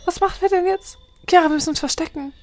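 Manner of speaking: high, hurried frightened voice; acted panic. Friend panicking about the clown in the flat, high and rushed. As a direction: rush the words in fright.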